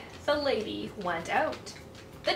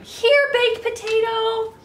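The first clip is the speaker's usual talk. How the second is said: higher, louder call across the house; 9 semitones higher, 6 dB louder than usual. Acted. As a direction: call the dog from afar, bright and loud. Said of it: "she called for him".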